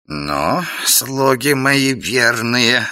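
very slow, drawn-out villain voice; a sinister old king addressing servants; Koschei voice. Acted. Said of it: "начал Кощей". (медленный зловещий голос Кощея)

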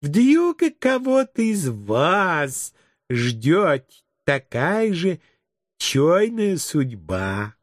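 slow, drawn-out teacher addressing little ones; cooing kindergarten teacher. Acted. Teacher to her little chicks, cooing slowly. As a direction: coo slowly with swooping pitch. Story figure: sugary teacher speech.

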